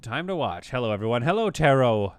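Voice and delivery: ordinary delivery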